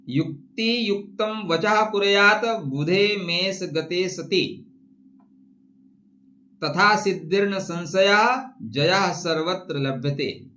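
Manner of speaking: slow, measured shloka recitation; Sanskrit verse recitation. Acted. Astrologer quoting a shloka to viewers, recitation. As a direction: recite the Sanskrit slowly, line by line.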